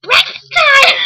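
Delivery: sudden high yell; a sudden shrieked breakfast call; shouted quote. Retold or imitated. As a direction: burst out with a loud, high cry. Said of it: "And he's like".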